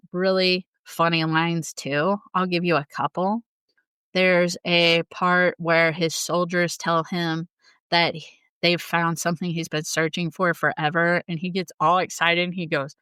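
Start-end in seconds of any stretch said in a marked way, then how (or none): none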